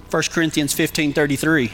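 ordinary talk, nothing marked

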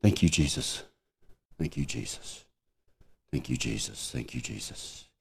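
hushed, low prayer of thanks; soft, spent gratitude, dropping to near whisper; repeated thanks. Retold or imitated. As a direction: murmur it over and over, fading. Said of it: "I just repeated three words over and over".

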